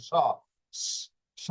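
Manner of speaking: "স" as a long whistling sh; hissed sho. Shown as a demonstration. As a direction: let a hiss of air lead into "স". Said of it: "এভাবে শীষ আকার বের হবে স". (শিস দিয়ে টেনে "স" উচ্চারণ)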